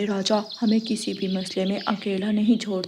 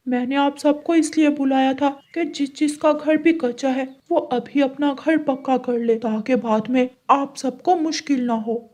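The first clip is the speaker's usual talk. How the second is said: royal announcement; 3 semitones higher than usual. Acted. King addresses assembled birds.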